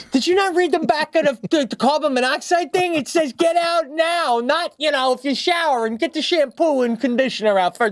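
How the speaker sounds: high-pitched incredulous scolding; exasperated outburst. Retold or imitated. Dad telling his wife to get out now, exasperated outburst.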